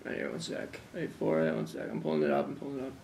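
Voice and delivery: very soft, distracted mumbling; barely audible filler while hunting for something; muttering while searching. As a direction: mumble under your breath, stalling for time. Stalling while searching for proof.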